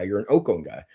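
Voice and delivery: ordinary delivery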